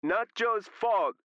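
high, slow, drawn-out shout; loud high yell from across the room; background shout. A narrator's reading. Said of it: "Danny shouted".

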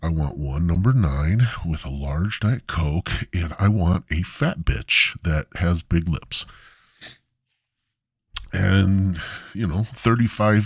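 ordinary talk, nothing marked

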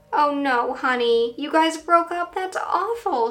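mother voice. Acted. Mother's voice in story.

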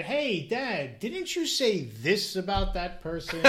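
a hint of an eager child calling Dad out; lightly imitated kid. Kid asking Dad about private talk, lightly imitated. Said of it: "one of my kids say".

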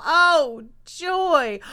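loud, high cry of delight; joyful outburst. A narrator's reading. Young beaver delighted at a new little sister, joyful outburst. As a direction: burst out joyfully, stretching each word.

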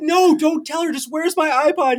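high, whiny mock plea; pleading teen. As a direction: beg him not to tell, then demand the iPod. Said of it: "And I said".

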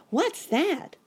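put-on very high, loud child voice; startled boy cry. A narrator's reading. Boy rabbit hearing a bang, high startled cry. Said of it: "cried Sammy".

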